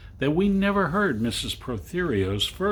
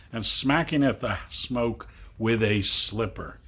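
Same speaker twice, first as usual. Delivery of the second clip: plain reading voice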